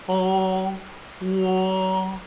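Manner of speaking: "O", then "wo", long and high on a level tone; model vowel sounds. Shown as a demonstration. Teacher modelling pronunciation, long high "O", "wo". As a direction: hold "O" and "wo" evenly like chanted notes. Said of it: "sama seperti bahasa Indonesia pelafalannya".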